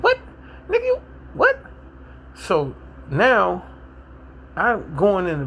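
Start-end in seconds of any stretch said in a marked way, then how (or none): 0.0-1.6 high-pitched incredulous retort; shocked disbelief. Retold or imitated. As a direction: squeal it out, utterly taken aback. Retells his shocked reply to a threat.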